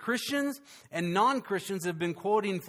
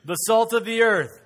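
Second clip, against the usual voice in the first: loud, higher, bold; call-and-response lead; 3 semitones higher, 9 dB louder than usual. Shown as a demonstration. Pastor leads congregation in repeating it, loud. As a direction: lead the crowd, shout it proudly.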